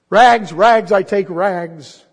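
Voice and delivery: loud, high street cry, slowing on the last words. Acted. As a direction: call out the wares though wounded. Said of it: "cried the sobbing, bleeding".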